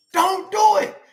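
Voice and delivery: sudden high preacher shout; shouted warning. Real, not acted.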